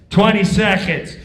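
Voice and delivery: loud countdown; stage manager calling thirty seconds. Retold or imitated. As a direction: bark the time left.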